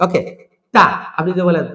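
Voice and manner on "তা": তা with a held, sharply released stop; hard T. Shown as a demonstration. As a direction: hold the T, then let it pop. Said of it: "একটু ধরে ছেড়ে দেওয়া". (শক্ত তা)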